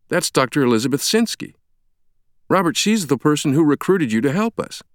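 high-pitched, urgent explanation; astonished colleague. Acted. Stranger tells Langdon who recruited him, astonished. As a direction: press the point on him.

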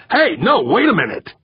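high, flustered outburst. A narrator's reading. Director halting Holly's unexpected registration, flustered. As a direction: blurt it out, caught off guard. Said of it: "his suddenly flustered expression".

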